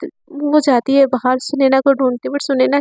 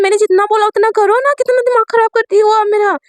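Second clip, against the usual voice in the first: angry yelling; 8 semitones higher than usual. Acted. Student yells at teacher about doing as told. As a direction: blow up at her in exasperation. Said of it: "इतना चिल्ला क्यों रही थी?".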